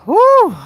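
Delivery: long whew. Real, not acted. Reacting after hearing the clip.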